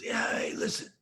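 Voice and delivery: unvoiced whispered rasp; Miles's whisper. Miles on the phone, whispering hoarsely. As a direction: hush it like a raspy secret. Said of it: "he goes".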